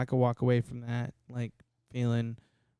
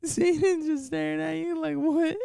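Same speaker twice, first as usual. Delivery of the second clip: high voice through laughter; laughing. Real, not acted. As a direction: squeal while cracking up. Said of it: "I'm fucking weak".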